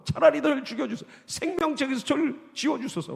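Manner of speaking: a lightly voiced Moses begging, pitched high; Moses pleading. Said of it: "막 그러면서 막는다고".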